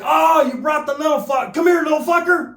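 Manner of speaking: rowdy high-pitched taunt; aggressive taunt. Retold or imitated. Acted quote of aggressive homie's greeting.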